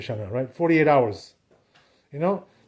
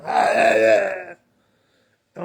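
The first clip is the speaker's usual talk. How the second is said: a hammed-up angry shout; mock yelling; 4 semitones higher, much slower than usual. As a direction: imitate someone yelling in shul. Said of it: "yelling, screaming at people".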